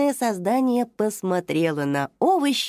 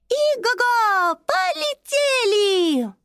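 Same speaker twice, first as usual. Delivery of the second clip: high, slow, gleeful neighing cry; excited cry; 13 semitones higher, slower than usual. Acted. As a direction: shout it like a playful pony. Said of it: "вскричала Мисоля".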